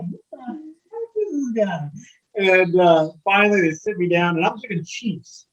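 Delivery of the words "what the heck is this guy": high, slow, baffled mock voice; baffled firefighters. Firefighters puzzled by a newcomer's homie handshakes, high and slow. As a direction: play the stunned crew squinting at the odd newcomer. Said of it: "And so they're just like".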